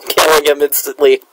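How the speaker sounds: ordinary delivery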